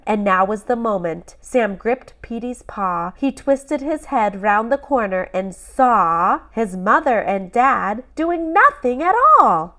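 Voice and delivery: ordinary delivery, nothing marked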